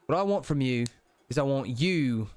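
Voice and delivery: deliberate Cockney with weighty pauses; Cockney deity counselling, phrase by phrase; Cockney British accent. Acted. Deity advises the player on power in a Cockney accent. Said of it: "kind of Cockney British accent".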